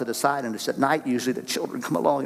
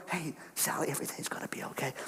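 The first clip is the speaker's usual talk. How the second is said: whispered, much softer reassurance; whispered comfort; 3 semitones higher, 14 dB quieter than usual. Retold or imitated. Older brother comforting sister in the closet, whispered. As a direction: whisper to a sibling hiding in a closet. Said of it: "as they hide".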